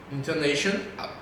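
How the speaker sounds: ordinary delivery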